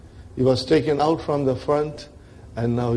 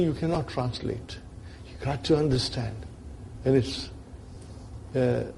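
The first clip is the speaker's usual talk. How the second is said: ordinary talk, nothing marked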